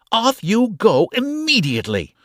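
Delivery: put-on proud aunt calling the girls away; cold, proud command. A narrator's reading. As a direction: shoo them off imperiously. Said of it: "she called, cold and proud".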